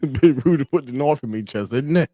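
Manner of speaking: put-on British accent. Streamer repeating the stabbing meme line, mock British accent. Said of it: "British people getting stabbed be like".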